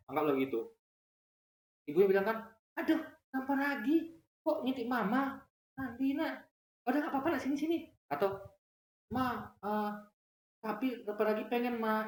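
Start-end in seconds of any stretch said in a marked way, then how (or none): 2.8-7.8 indulgent mother. Retold or imitated. Mother soothing her son who peeked, high and indulgent. 9.1-12.1 put-on lightly higher pleading kid; child's voice. Son asking his mother why, lightly childlike.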